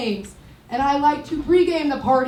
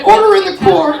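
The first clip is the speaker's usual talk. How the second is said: louder, higher order in the court; mock judge; 8 semitones higher, 10 dB louder, faster than usual. Comic playing judge during a date, mock judge shout. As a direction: bang it out like a judge. Acted judge line with gavel.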